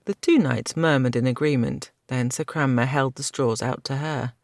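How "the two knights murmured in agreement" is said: usual speaking voice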